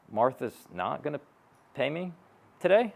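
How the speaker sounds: acted stunned question, broken by pauses; blindsided disbelief. Salesperson blindsided by a client's objection, stunned and halting. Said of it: "you just get blindsided by this objection".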